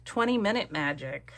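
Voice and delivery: normal talking voice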